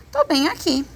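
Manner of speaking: put-on high-pitched child reply; little boy answering. A narrator's reading. Invisible boy answers his mother. (voz aguda de menino)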